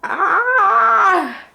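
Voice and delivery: high, loud squeal of excitement; sudden delighted shriek; excited scream. Real, not acted. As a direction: shriek with thrilled nerves. Opening her DNA results.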